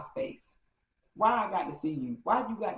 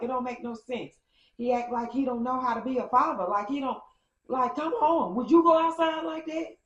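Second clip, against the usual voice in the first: rising from high to much higher and louder; building rant; 8 semitones higher, 4 dB louder than usual. Retold or imitated. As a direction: crescendo into the final question. Delulu still ranting, climbing.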